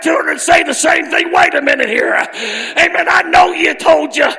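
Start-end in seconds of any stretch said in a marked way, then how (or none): none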